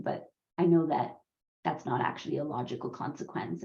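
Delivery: normal talking voice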